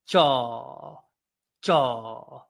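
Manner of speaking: each JAW stretched long and falling; elongated, separate "jaw", held like an exercise; drawn-out demonstration. Shown as a demonstration. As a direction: draw out the vowel in every JAW. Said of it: "allow that word to elongate".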